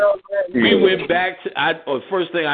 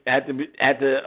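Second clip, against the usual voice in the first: ordinary talk, nothing marked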